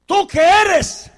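very slow, high and loud; demanding question. Acted. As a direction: drag out the challenge.

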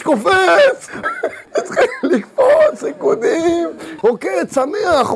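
very high laughing, slowing at the end; laughing ecstasy. Acted. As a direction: laugh it out high, then draw it out. Shabbat dancing again.

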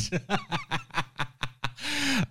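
quiet, fading real chuckle; soft laughter. Real, not acted. Host still chuckling at the chorus, soft laughter. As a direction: laugh it off under your breath.

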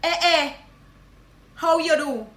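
lively Guyanese Creole greeting. Shown as a demonstration. Guyanese teacher greeting a long-lost friend, Creole. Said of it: "Number one".

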